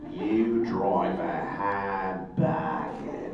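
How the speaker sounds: low, very slow Australian drawl; put-on Australian accent. Answering a joke about pay, slow Australian drawl. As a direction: stretch every word in low Australian.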